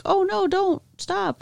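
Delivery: high, slow pretend plea; mock half-hearted protest. Imagining passenger warning the driver, mock feeble alarm. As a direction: imitate a helpless warning, drawn out. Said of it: "would have been like".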